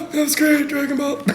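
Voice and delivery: high, strained mock rasp after screaming; blown-out voice. After the screaming session, a mock strained, high rasp. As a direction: croak it out as if the voice is shot. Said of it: "and you're like".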